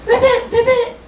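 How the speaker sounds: high squeaky ribbits; baby frog croak. Acted. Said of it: "she has learned".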